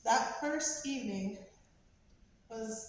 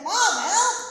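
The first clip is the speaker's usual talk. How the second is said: loud high mock child's yelp; panicked cry for help; 10 semitones higher, 14 dB louder than usual. Son calling to his mom for help, high panicked yell. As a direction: burst out like a kid scared he'll lose the fish. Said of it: "He's like".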